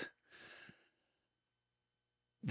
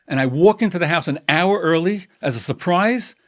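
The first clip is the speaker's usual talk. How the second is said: higher, louder aggrieved outburst; husband defending his surprise homecoming to his wife; indignant husband; 5 semitones higher, 4 dB louder than usual. Acted.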